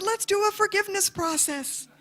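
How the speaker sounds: bursting with joy at making peace; elated plea. Retold or imitated. Excited proposal to driver.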